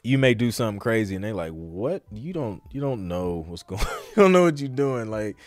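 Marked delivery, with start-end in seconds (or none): none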